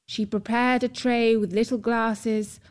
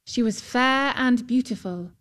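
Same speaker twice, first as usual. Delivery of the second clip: ordinary delivery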